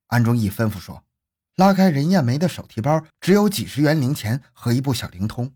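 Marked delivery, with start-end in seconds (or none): none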